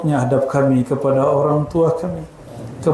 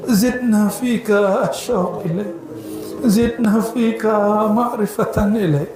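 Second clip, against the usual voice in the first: weeping prayer; 5 semitones higher than usual. Real, not acted. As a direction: let the longing spill over into real tears. (doa sambil menangis terisak)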